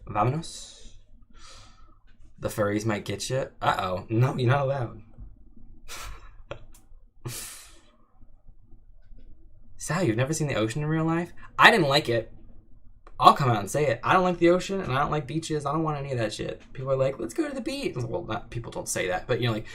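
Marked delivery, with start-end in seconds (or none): 0.0-1.0 lightly put-on Spanish accent; a hint of a Spanish accent; mock Spanish accent. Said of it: "Oh, the Spanish one". 17.2-18.0 mock beach lover.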